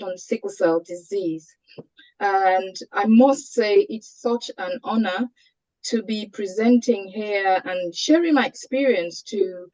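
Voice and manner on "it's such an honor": ordinary talk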